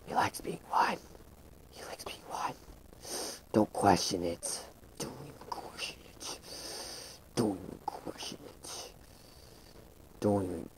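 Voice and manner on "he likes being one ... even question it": breathy, much softer whisper; playful whisper. Acted. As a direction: hush it like a secret. Repeats the line in a hush.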